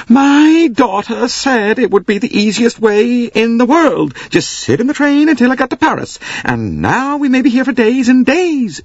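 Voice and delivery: high, loud wailing American lady. Acted. Missus Hubbard wailing to the passengers, high and loud. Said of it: "she wailed".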